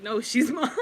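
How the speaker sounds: mock-possessive, cracking up mid-line; laughing jealous rival. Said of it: "extremely jealous of each other".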